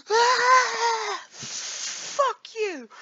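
high startled yelp then a curse, imitating her; startled angry wife. Imitating his partner after a jump-scare video, high shriek. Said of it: "she's like".

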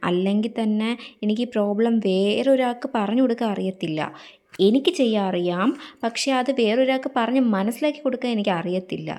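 normal talking voice

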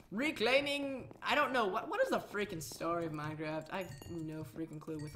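ordinary talk

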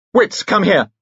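high, urgent call to next room. A narrator's reading. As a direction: summon a friend from another room. Donald calls to next room.

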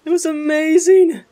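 high, loud delighted burst with a laugh; thrilled. Real, not acted. Player thrilled by teleporting, loud happy gush.

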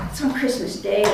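quiet, low, heavy words; hushed grief. Real, not acted. Medic telling of the death, hushed and low. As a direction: let it sink in softly. Repeated after time of death.

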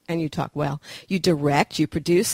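ordinary talk, nothing marked

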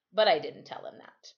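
usual speaking voice

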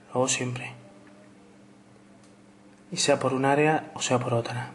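soft, whispery perfume commercial voice; sultry perfume ad. Acted. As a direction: breathe it like a luxury fragrance ad. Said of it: "me acabo de hacer un anuncio de perfumes".

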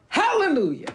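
usual speaking voice

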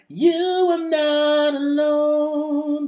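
thin, pinched manufactured tone. Shown as a demonstration. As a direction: sing with the larynx lifted. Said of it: "This is more manufactured than".

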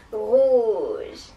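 long, evil witchy drawl; really evil witch. Shown as a demonstration. Teacher shows class how to sound evil, witch voice. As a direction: draw it out wickedly. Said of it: "make it sound really evil".

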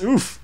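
high yelped game oof sound; Roblox oof. Retold or imitated. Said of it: "You know, like, uh, Roblox".

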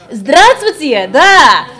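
Здравствуйте said slowly and loudly, every syllable drawn out; Russian hello. Shown as a demonstration. Speaker showing the audience Russian hello, slow and loud. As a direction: spell out Здравствуйте slowly for the room. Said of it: "say hello in Russian".

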